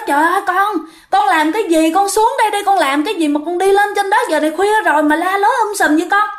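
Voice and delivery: high, anxious call up to a rooftop; mother calling up to her son on the roof, high and worried. Retold or imitated.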